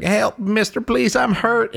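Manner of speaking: high-pitched put-on plea; hurt man pleading. Roadside guy luring a rider, high pleading. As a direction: beg for help like a fake victim. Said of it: "you see a guy who's".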